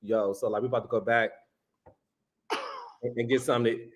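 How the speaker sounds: low, laid-back pickup line; deep masculine voice. Shown as a demonstration. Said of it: "I would be like".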